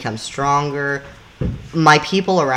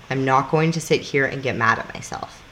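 ordinary delivery

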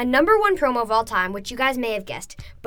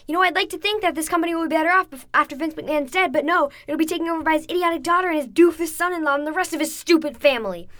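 fast, higher, louder ranting promo imitation; imitating CM Punk; 6 semitones higher, 4 dB louder than usual. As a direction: rattle it off like a heated wrestling rant. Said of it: "He goes".

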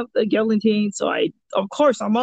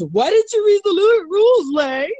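teasing jab; 10 semitones higher, 4 dB louder than usual. Retold or imitated. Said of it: "She goes".

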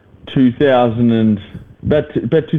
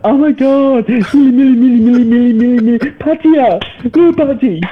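very high, loud, hammed-up tourist voice; 5 dB louder than usual. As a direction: squeal it out like a thrilled tourist joining the chant. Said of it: "and they're like".

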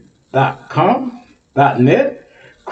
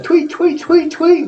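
high chirpy tweet tweet; bird tweeting; 11 semitones higher than usual. Pretend.

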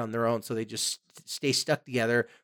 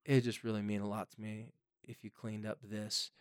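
much softer, calm, low-key asking; a parent gently asking a teen to clean their room; gentle request; 5 semitones lower, 7 dB quieter than usual. Shown as a demonstration. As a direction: coax them kindly, like talking to a friend. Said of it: "I'm just gonna say".

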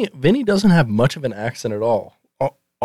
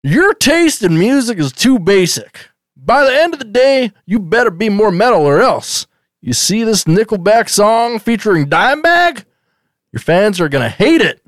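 higher-pitched scolding father parody; mock angry dad; 6 semitones higher than usual. Father ordering Vinny to become a metal drummer, in a higher mock-angry voice. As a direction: lecture him like a fed-up sitcom dad.

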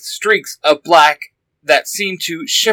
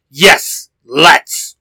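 shouting. A narrator's reading. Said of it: "one oh two shouted".

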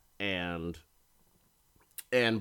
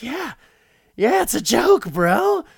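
high, very loud sarcastic shout; hammed-up shrieked reply; mock manic retort; 12 semitones higher, 10 dB louder than usual. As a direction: shout back with exaggerated false agreement.

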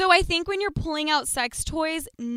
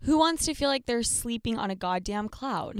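ad read; 2 semitones lower, 6 dB quieter than usual. Acted. Ad read, host voice.

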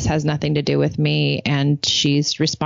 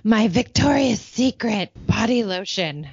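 imitating a furious daughter, higher, drawn out; mock-outraged teen accusation; mad teenager; 4 semitones higher, slower than usual. Teenage daughter accuses mom over ruined lotion, mad. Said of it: "like, mad".

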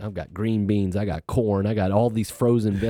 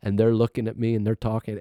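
normal talking voice

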